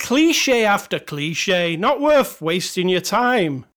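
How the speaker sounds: put-on slow, drawn-out Scottish accent; laboured Scottish review reading; bad Scottish accent. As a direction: stretch the words in amateur Scottish. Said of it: "That's all I can do in Scottish".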